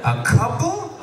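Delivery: as if a couple is far too many; incredulous. Real, not acted.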